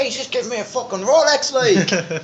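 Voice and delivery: high, half-mock excited yell; shouting over the song about the stranger's Rolex, mock excited; retold scream. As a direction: squeal it up high in disbelief. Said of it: "I scream for him".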